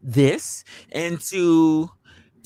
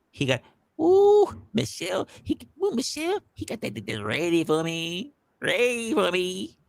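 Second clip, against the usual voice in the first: put-on high, swooning old woman voice; old lady voice; 4 semitones higher than usual. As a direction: coo it like a smitten granny. Voicing horny old Janice.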